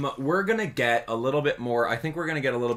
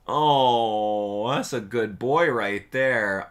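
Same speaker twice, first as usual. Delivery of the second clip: cooing at cat; 7 semitones higher, 6 dB louder, much slower than usual. Real, not acted. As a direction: melt over a cute kitten.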